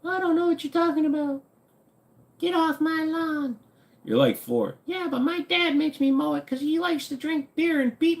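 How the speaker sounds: put-on high squeaky little kid voice. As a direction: play a whiny little kid.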